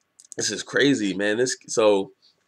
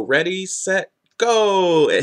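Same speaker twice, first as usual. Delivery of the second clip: higher-pitched, louder playful countdown; excited call; 10 semitones higher, 5 dB louder, much slower than usual. Retold or imitated. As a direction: call it out, drawing out a big "go". Acting out her playful starting call.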